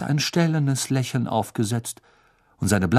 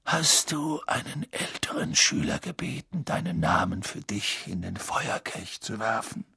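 put-on gentle quiet old wizard; soft and kind; calm; 6 dB quieter than usual. Dumbledore voiced.

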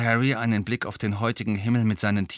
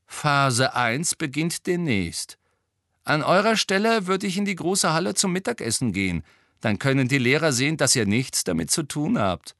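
higher-pitched, brisk twin voice; lively higher voice for the twin; lightly voiced cheeky twin; 7 semitones higher, 4 dB louder than usual. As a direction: play one of the troublemaking twins, pitched up.

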